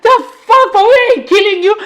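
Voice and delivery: squeaky, over-the-top what-the-fuck; exaggerated disbelief. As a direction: ham up a friend's baffled cry.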